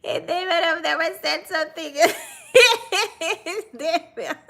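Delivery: words broken up by laughter; high-pitched laughing through the line. Real, not acted. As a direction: try to talk while cracking up. Cracking up mid-sentence.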